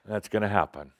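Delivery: ordinary talk, nothing marked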